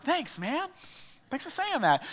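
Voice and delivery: high, dry sarcastic thank-you. Retold or imitated. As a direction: toss off a mock-grateful thanks. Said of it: "you can be really sarcastic".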